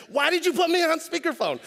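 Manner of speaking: high, loud mock outrage; outraged wife. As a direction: imitate a wife yelling at her husband, pitch way up. Acted wife outraged on speakerphone.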